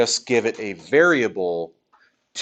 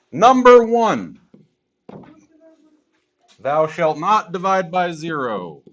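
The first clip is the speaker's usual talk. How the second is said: biblical proclamation; 6 semitones higher, 4 dB louder than usual. Acted. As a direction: proclaim it like a commandment. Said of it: "Mathocles comes down from the mountaintop".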